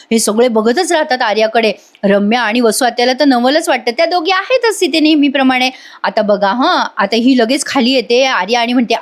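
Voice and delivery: usual speaking voice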